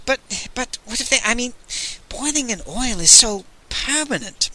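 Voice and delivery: high, quick stammering worry; anxious protest. Acted. Magnan fearing boiling in oil, high anxious stammer. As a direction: plead in a nervous rush. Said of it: "Magnan persisted".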